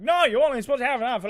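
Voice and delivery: alarmed scolding. Retold or imitated. Imitating nurse hearing he iced it for hours, alarmed scolding. Nurse corrects him about the ice.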